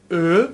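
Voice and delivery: "ا" as French eu, lips pursed, rising; rounded-lip vowel. Shown as a demonstration. Teacher shows how it must be pronounced, "ا" lip-rounded. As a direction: push "ا" out through rounded lips. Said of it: "با قنچه کردن لب".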